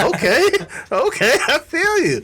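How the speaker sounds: high, mock-enthusiastic agreement; agreeing coworker. Cheering on the sixty-year-old coworker, high and amused. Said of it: "I said".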